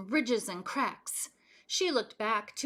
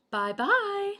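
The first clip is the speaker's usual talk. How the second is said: middle-sized bear voice; 11 semitones higher, 5 dB louder than usual. A narrator's reading. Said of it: "a middle-sized voice".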